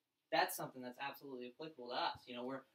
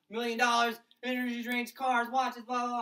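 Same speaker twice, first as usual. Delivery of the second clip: louder, higher mock sales hype; hyped pitch; 7 semitones higher, 9 dB louder than usual. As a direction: rattle it off like an overexcited recruiter. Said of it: "He's like".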